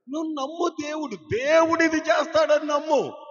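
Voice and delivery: rally the congregation into faith; fervent preaching. Acted. Pastor rousing the congregation to believe, high and loud.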